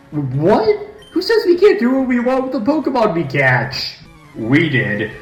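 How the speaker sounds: high, defiant villain goon; high thug voice. Acted. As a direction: sneer it like a cocky henchman. A villain grunt talks back.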